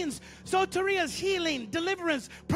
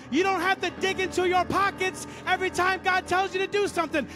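raised, driving preacher's delivery; fervent preaching; 3 semitones higher, 4 dB louder than usual. Real, not acted. Preacher urging the congregation to give by faith, fervent. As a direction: preach it with rising intensity. Preacher builds to full force.

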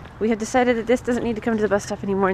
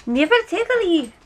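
put-on lilting Scottish accent, pitched up; 6 semitones higher, 3 dB louder than usual. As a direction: put on a playful Scottish brogue. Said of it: "So then I went to Scottish".

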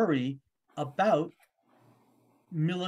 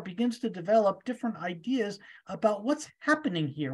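normal talking voice

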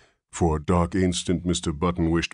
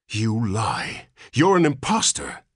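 put-on indignant old man; 6 semitones higher, 4 dB louder than usual. A narrator's reading. Old man accuses father.

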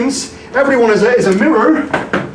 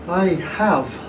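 stunned hush; 5 semitones lower, 9 dB quieter than usual. Acted. Alone, stunned after son leaves.

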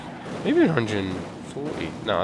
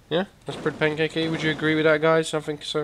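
put-on high voice; 7 semitones higher, faster than usual. Puts on voice, agrees with himself.

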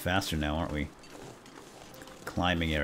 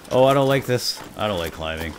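ordinary talk

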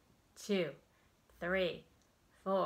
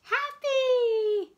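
much higher and louder, bursting with joy; happy; 14 dB louder than usual. Acted. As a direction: squeal it like a delighted kid. Reading feeling words with expression.

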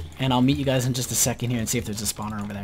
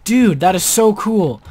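slow, loud awe; excited; 6 semitones higher, 7 dB louder, slower than usual. Real, not acted. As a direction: savor the thrill. Thrilled about spawner.

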